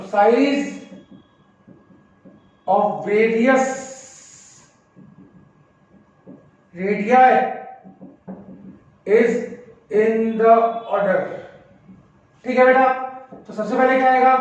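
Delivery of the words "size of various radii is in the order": drawn-out words with long gaps; slow dictation. Shown as a demonstration. Teacher to class, dictating the order of radii slowly. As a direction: dictate so everyone can write it down.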